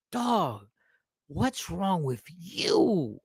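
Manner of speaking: a hint of a weary woman; lightly voiced stunned woman. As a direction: say it slowly, past being mad. Stunned woman past anger.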